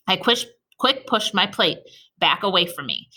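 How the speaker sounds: usual speaking voice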